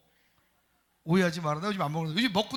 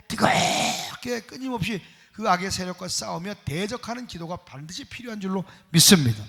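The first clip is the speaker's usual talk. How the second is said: fierce rebuke; 6 dB louder than usual. Acted. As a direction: cast them out with force. Rebuking spirits, full-force. (어둠의 영을 꾸짖는 힘찬 선포)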